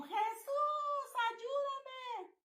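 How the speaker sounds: high, drawn-out wail to Jesus, without full volume; retold full-lung cry. Woman retells calling on Jesus against a demon, retold high cry. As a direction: reenact a long, high-pitched cry for help. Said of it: "grité a todo pulmón".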